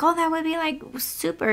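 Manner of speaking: high-pitched mimicry of a pleased rider; imitated delight. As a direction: lift it high, as her grateful reply. Mimics rider's grateful reply.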